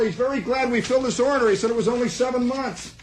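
put-on high, pleased young voice; proud kid. Clerk proudly telling Mister Moss the news, high eager voice. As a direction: report it proudly.

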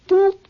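high, loud imitated whistle blast; whistle boop. Lab men blow the whistle again, high loud boop. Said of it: "Then they go".

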